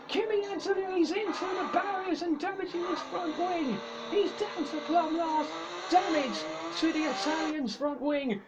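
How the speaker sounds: energetic race play-by-play; motorsport commentator. Acted. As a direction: call the restart live.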